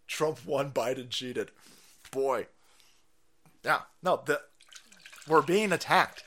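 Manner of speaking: usual speaking voice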